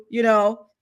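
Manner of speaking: ordinary talk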